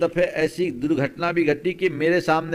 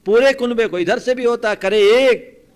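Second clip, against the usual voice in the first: loud, high-pitched objection; vehement preaching; 8 semitones higher, 10 dB louder than usual. Acted. Preacher protesting a whole family being blamed, loud and vehement. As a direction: raise the voice to protest. Rising indignation in the sermon.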